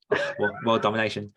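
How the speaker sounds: ordinary talk, nothing marked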